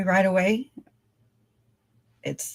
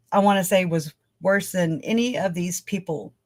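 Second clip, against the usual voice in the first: ordinary talk, nothing marked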